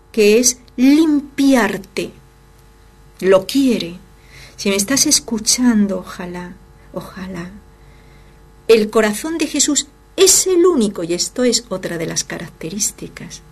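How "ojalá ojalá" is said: soft hope. Real, not acted. Preacher hoping listener is reached.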